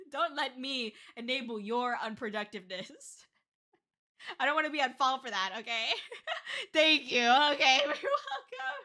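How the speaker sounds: ordinary talk, nothing marked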